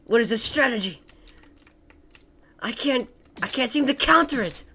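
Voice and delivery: lightly voiced anime villain; a hint of a dramatic anime villain. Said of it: "talking like an anime villain".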